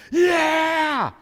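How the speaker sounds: high, full-force mob yell; riled-up crowd. Retold or imitated. Preacher acting out a crowd being stirred against Paul, riled-up yell. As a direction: roar along like an incited crowd. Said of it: "he's got 'em all riled up".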